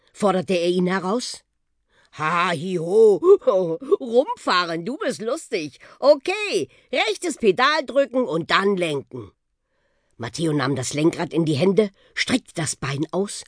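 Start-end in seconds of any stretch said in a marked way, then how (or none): 2.1-9.3 hearty stagy ha-hi-ho laugh into high, lively speech; jovial, swooping old bus character; amused bus laugh. Acted. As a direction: laugh at the boy, then give cheery instructions. Bus laughs at boy's challenge.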